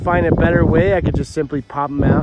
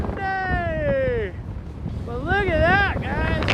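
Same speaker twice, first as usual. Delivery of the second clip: excited; 11 semitones higher, 4 dB quieter, much slower than usual. Real, not acted. Awed at windy summit.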